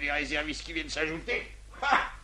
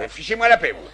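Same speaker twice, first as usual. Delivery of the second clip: annoyed; 3 semitones lower, 8 dB louder than usual. Acted. Adjudant brushing off the father.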